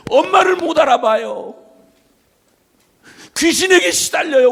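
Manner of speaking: high, loud pleading shout; desperate cry. Retold or imitated. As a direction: cry out as if your child is dying. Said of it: "하고 막 간절히 외치기 시작했어요".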